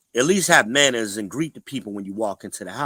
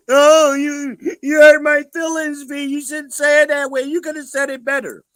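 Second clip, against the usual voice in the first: much higher, louder mock-wounded whine; mock hurt whine; 13 semitones higher, 7 dB louder than usual. Shown as a demonstration. Mocking the woman who finds his words too harsh, in a high whining falsetto. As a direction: whine like someone whose feelings got hurt. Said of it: "I'm gonna say it both ways".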